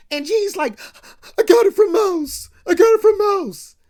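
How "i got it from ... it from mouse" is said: much higher, loud blubbering admission; crying confession. Retold or imitated. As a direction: sob it out like a scared kid. G breaks down, admits source.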